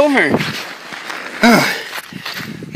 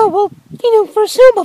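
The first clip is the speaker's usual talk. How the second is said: high character voice; 13 semitones higher, faster than usual. Acted.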